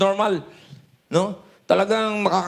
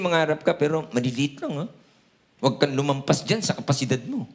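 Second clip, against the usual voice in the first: voicing the enemy; 6 semitones lower than usual. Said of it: "pwede naman niya sabihin".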